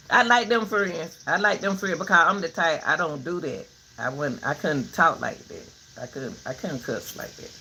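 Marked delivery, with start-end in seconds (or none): none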